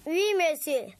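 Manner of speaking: ordinary delivery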